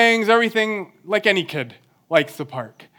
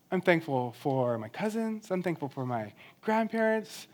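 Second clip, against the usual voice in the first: put-on calm thankful child; 11 dB quieter than usual. Calmed child listing thanks.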